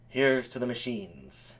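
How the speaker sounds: low, slow, soft toast; quiet toast. Real, not acted. Host toasting the machines, quiet and solemn. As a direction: raise a slow, subdued toast.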